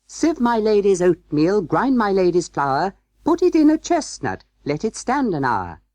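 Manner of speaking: high, gaily chanted nursery rhyme; little mouse voices. A narrator's reading. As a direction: chant it brightly like small merry mice. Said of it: "the little mouse voices went on again".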